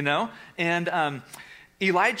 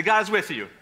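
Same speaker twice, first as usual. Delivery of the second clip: louder, bigger; 4 semitones higher, 4 dB louder than usual. Shown as a demonstration. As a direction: say it louder with a laugh. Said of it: "do better than that".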